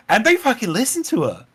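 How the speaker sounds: ordinary talk, nothing marked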